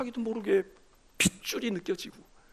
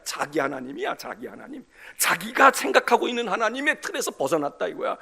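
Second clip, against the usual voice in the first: ordinary talk, nothing marked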